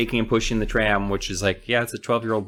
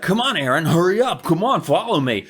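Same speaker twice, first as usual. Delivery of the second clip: eager urging; 8 semitones higher, 3 dB louder than usual. Retold or imitated. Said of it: "He's like".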